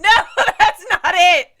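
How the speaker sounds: loud, high, laughing protest; laughing shout. Real, not acted. Cohost protesting a rigged question, laughing shout. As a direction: yell it out through laughter.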